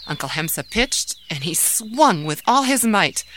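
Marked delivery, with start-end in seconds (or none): none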